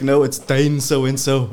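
pretending to be him. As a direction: pose as the ex's new man. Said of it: "then I'd say I'm him".

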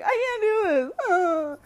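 high, whiny fake breakdown wail; hammed-up sobbing meltdown; mock crying. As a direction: imitate someone freaking out in tears. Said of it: "freaks out and starts crying".

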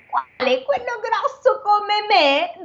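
put-on high, very slow little boy asking; child's voice. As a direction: play the small boy, wide-eyed and slow. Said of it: "E il bambino".

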